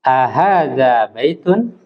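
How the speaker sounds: slow rising question on "baitun"; teacher modelling a question; model question. Shown as a demonstration. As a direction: ask slowly, rising at the end. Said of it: "Sekali lagi coba".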